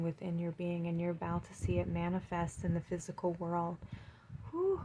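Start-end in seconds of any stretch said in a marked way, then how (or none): 4.5-4.8 high breathy exhale of emotion; emotional whew. Real, not acted. Reader overwhelmed mid-reading, high emotional whew. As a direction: let the feeling out in one high puff.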